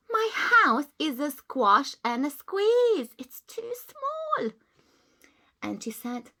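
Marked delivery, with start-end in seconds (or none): 0.1-4.5 put-on slow, high, whimpering old lady; complaining old lady. Old lady lamenting her tiny house, whimpering. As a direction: whimper about the cramped house.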